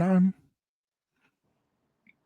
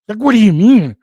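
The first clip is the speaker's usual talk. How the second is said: indignant retort; 7 semitones higher, 13 dB louder than usual. Retold or imitated. Retold indignant reply.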